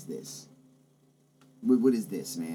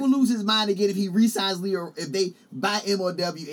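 heated loud; 9 semitones higher, 6 dB louder than usual. Real, not acted. Heated argument about Vince.

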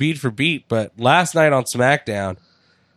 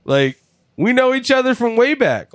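much higher, whiny mock pleading; imitating Sin Cara; 11 semitones higher than usual. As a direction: act hurt at being snubbed by an old friend. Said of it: "but he's like".

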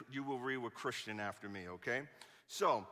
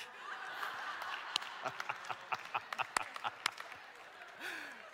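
breathless laugh at his own joke; silent wheezing laugh; 9 semitones higher, 5 dB quieter than usual. Real, not acted.

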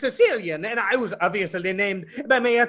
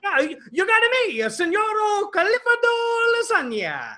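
hammy fake Italian accent, pitched higher; 9 semitones higher than usual. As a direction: roll out the name like a cartoon Italian. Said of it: "this fakey Italian accent".

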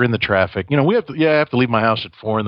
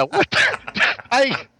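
laughing disbelief; much slower than usual. Real, not acted. Bursting out laughing at story.

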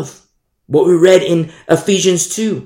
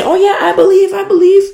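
high, loud mocking singsong; mock shallow believer; 7 dB louder, faster than usual. Preacher mocks a glib believer to the congregation, high and loud. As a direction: parody a glib believer brushing it off.

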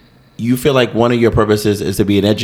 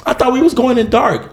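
surprised therapist; 12 semitones higher than usual. Retold or imitated.

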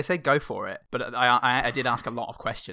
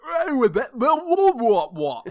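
high, loud hammed-up Mary Poppins Cockney accent; 13 semitones higher, 8 dB louder, slower than usual. Symbiote speaking in a bad Mary Poppins Cockney accent.

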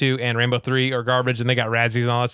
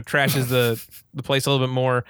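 ordinary talk

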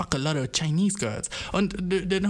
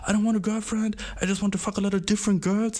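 guy refuses a girlfriend in a higher mock voice; imitating the guy; 8 semitones higher than usual. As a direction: mimic his boastful line. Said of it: "Und er war dann so".

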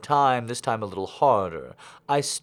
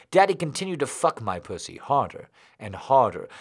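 usual speaking voice

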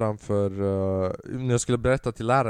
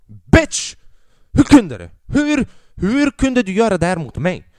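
louder and higher, betrayed fury; outraged shout; 8 semitones higher, 9 dB louder than usual. Retold or imitated. Father yells at bullied son as if betrayed, outraged shout. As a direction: yell it as if the team lost the final.

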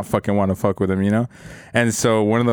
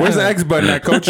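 higher, laughing mock question to coach; mock clueless rookie; 5 semitones higher, 3 dB louder than usual. Rookie asking coach about buttons, laughing mock cluelessness. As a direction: play the lost gamer asking coach, laughing. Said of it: "mocking himself as a rookie".

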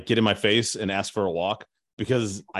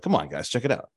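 real estate agent voice. Pretend. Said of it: "get into my real estate agent voice".

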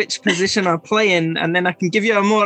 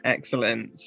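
usual speaking voice

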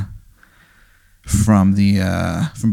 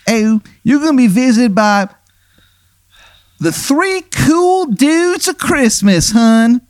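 high, hammy Baltimore "hun" voice; the worst Maryland accent you've ever heard; bad Maryland accent. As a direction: play a ghost with a terrible Maryland accent, way up high. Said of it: "and he goes".

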